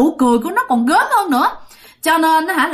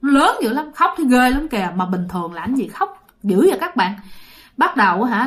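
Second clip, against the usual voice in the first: ordinary delivery, nothing marked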